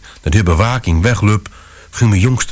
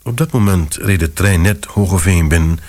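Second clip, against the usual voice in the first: ordinary delivery, nothing marked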